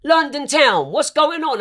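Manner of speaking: high, loud mock shout-out; hammed-up hype greeting. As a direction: call out London town like a host. [name] greeting on her live.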